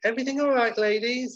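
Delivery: high, loud singsong call. Acted. Hotelier calling to the ladies from reception, high loud call. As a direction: call across the lobby to guests. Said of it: "calling from reception".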